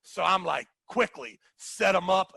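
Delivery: ordinary talk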